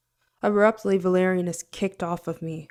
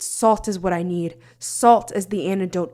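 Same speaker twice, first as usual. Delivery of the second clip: usual speaking voice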